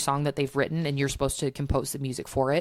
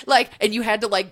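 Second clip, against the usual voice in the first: ordinary talk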